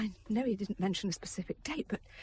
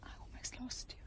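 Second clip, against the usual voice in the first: tearful whisper; 3 semitones higher, 13 dB quieter than usual. Acted. Wife tearful at bedside.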